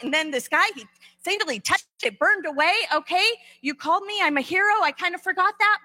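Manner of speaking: high, eager hammed-up hype; pumped-up Gideon. Gideon rallying himself before the battle, high and eager. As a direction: talk himself into being the hero.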